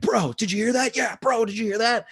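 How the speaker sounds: high, loud mock ghost hunter; ghost show bros. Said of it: "they're like".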